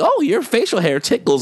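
high sweet mock mother voice; mock mom voice. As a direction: coo it like a doting mom. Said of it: "the mom be like".

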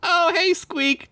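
put-on high boyish greeting; young squirrel boy. Derek answers his best friend, in a high boyish voice. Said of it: "Derek said".

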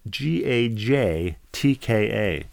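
slow insistent letter spelling. Shown as a demonstration. Host correcting the co-host by spelling slowly. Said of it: "No, no, no".

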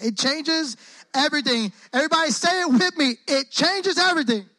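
high, loud, full-voiced proclamation; rallying call. Shown as a demonstration. Preacher getting everybody to say it with him, rallying call. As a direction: belt it out so the whole room joins in. Leads the crowd saying it aloud.

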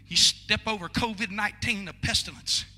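ordinary talk

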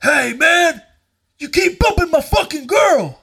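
high, loud angry shout; angry man confronting. Retold or imitated. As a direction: yell it like an aggrieved boyfriend squaring up. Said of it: "this angry man's face, and he goes".

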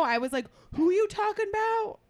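normal talking voice

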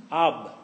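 Ab said short with a clear b; the Aramaic word Ab, one clipped syllable. Shown as a demonstration. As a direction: Ab as in Aramaic for father. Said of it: "you said father was A V".

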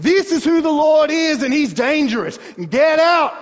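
scared Egyptians screaming get out; terrified shout. Acted. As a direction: drive them out in panic. Egyptians terrified, driving Israel out.

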